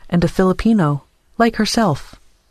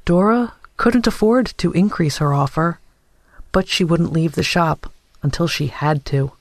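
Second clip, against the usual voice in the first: ordinary delivery, nothing marked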